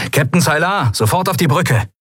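high, urgent summons; calling out. A narrator's reading. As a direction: call the captain through a speaking tube, pitched high. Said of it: "rief Sephiron in eines der Sprechrohre".

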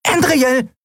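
tiny piping high squeak; squeaky little voice. Acted. Nelen squeaks to wake Endriel, piping high. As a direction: squeak her name like a tiny creature. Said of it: "piepste eine Stimme".

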